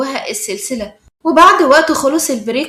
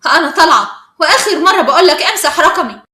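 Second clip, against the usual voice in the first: loud, irritated final warning; annoyed; 3 semitones higher, 8 dB louder than usual. A narrator's reading. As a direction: warn him off, raised and cross. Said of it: "وقالت بضيق".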